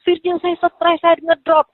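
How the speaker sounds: high-pitched mock complaint; retold outburst. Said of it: "awal dia ngamuk tuh Kak Emma".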